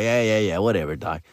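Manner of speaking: low, imitated brush-off; mock dismissive. Visitor unimpressed by slides and pictures, mock dismissive. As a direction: act out a listener who isn't buying it, pitch dropping.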